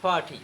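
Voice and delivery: পার্টি with a puff on P and no R; aspirated P, silent R. Shown as a demonstration. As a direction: say পার্টি as "phaa-ti". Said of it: "কি হবে?". (পার্টি, র ছাড়া ফাটি)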